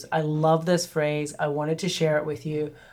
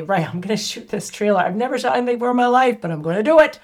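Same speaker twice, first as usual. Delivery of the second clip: brave resolve; 5 semitones higher, 4 dB louder, faster than usual. Retold or imitated. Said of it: "so courageous of me to say".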